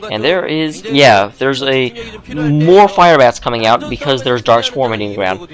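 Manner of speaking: ordinary talk, nothing marked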